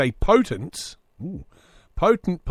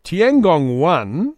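slow, drawn-out and louder, like a trailer voice; grand slow read; 3 semitones higher, 6 dB louder, much slower than usual. Pretend. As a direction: stretch it out for mock grandeur.

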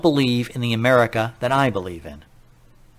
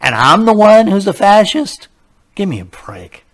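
higher, louder incredulous protest; indignant outburst; 8 semitones higher, 8 dB louder than usual. Real, not acted. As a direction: fire back in disbelief.

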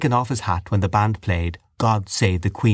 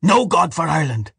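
raised, higher-pitched angry cry; defiant cry; 8 semitones higher, 3 dB louder than usual. A narrator's reading. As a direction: proclaim it defiantly. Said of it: "he cried".

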